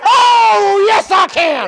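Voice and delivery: loud, high, drawn-out "oh" into a cry; exultant shout. Real, not acted. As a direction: peak of a revival-style chant. Climax of audience chant.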